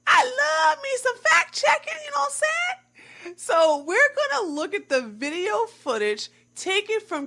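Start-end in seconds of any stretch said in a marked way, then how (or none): 0.1-3.4 excited. Real, not acted. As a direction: squeal with glee about fact checking. Gleeful about fact checking.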